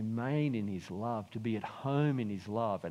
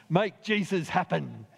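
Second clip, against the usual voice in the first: raised, loud mock rallying cry; brash young man; 6 semitones higher, 5 dB louder than usual. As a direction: proclaim it brashly, imitating a cocky young speaker. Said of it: "a brash young twenty-five-year-old man at the front saying".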